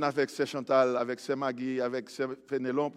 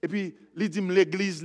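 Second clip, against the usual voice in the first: normal talking voice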